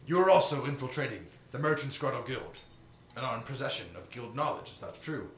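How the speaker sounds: stern admiral. Acted. Admiral questions prisoner.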